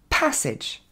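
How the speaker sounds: PASSAGE as "PHASS-edge" with a puff on the P; aspirated English plosive. Shown as a demonstration. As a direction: release air on the P of PASSAGE. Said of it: "on a une relâchée d'air en anglais".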